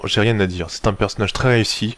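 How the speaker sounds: usual speaking voice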